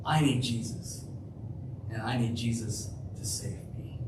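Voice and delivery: soft earnest confession; hushed confession. Real, not acted.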